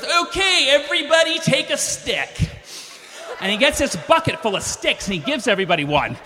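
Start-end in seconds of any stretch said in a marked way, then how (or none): 0.0-2.5 higher, slow mock yokel captain. Boat captain explains the plan, mock yokel voice. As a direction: bark it out like a laid-back boat captain. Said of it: "He goes".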